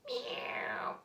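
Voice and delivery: soft high cat meow; breathy little meow; whispered meow. A narrator's reading. Imitating the cat.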